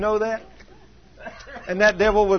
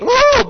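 loud, high woo of remembered pain; high whoop; 11 dB louder than usual. Real, not acted. Preacher whooping at mama's shoulder squeeze, loud and high. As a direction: whoop at the thought of it.

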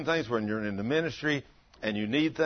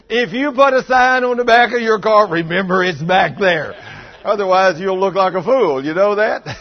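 raised, forceful preaching voice; loud preaching; 7 semitones higher, 12 dB louder than usual. Real, not acted. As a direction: warn the crowd loudly and firmly. Preaching warning to congregation.

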